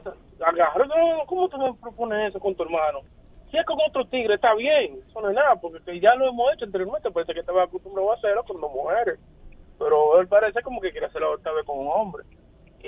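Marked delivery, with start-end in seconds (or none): 0.9-12.2 higher-pitched, softer voicing of the woman; lightly voiced scandalized woman; mimicking the woman. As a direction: sketch her as offended but negotiable. Voicing the woman in his story.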